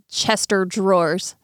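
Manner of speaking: slow, deliberate "Chester drawers"; imitating a slip, Chester for chest of; mispronunciation quoted. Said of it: "she said".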